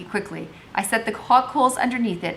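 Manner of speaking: usual speaking voice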